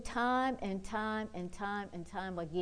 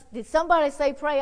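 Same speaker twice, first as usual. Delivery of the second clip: a hint of an eager little grandma, pitched up; 7 semitones higher, 10 dB louder, faster than usual. Little grandma popping round the aisle, eager. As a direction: chirp it like a keen old lady. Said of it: "She's like".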